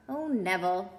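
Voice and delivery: usual speaking voice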